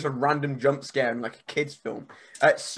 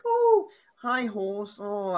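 put-on much higher, softer little-kid greeting; child's voice; 4 semitones higher, 4 dB quieter, much slower than usual. Retelling a film scene, a little girl greeting a horse. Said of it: "this kid's like".

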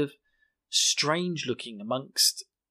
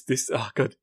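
usual speaking voice

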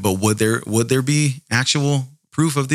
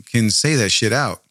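ordinary delivery, nothing marked

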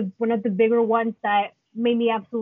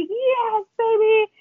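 excited cheer; 10 semitones higher, slower than usual. Retold or imitated. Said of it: "I was like".

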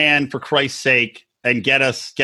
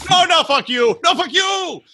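mock shouting cameraman; 13 semitones higher than usual. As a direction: yell it back high like an insulted cameraman. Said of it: "And it's like".

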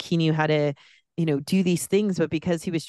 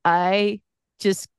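choked up, voice lifting and wavering with real emotion; really fighting back tears; near tears; 3 semitones higher, slower than usual. Real, not acted. Film fan recalling how the ending hit her, choked up. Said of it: "I'm gonna cry again".

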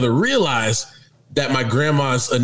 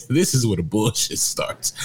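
normal talking voice

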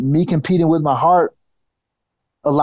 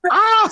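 sudden high-pitched yelp of shock; startled yelp. Retold or imitated. Telling a friend how the boss's insult landed, a startled yelp. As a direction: yelp like you just got hit with doubt. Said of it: "And I was like".

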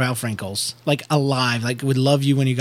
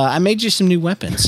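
casual villain voice; 5 semitones higher than usual. Acted. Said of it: "he says casually through slitted eyes".

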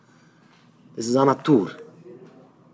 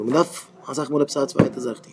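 ordinary delivery

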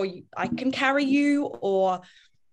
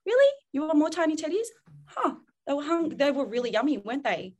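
put-on playfully curious, much higher questioning to a child; 5 semitones higher, faster than usual. Shown as a demonstration. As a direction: sound genuinely intrigued by her request. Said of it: "is just get curious".

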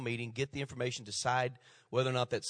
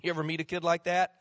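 ordinary talk

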